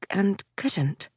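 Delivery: ordinary delivery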